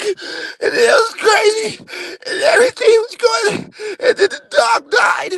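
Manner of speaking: retelling her dead dog in hammed-up sobs; mock sobbing Janet. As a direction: wail it like a hysterical crying woman. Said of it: "Janet started crying".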